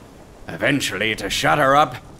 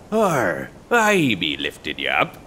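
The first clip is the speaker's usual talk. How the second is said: ominous; 9 semitones lower than usual. Acted. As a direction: let the threat sit under the words. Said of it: "real ominous like".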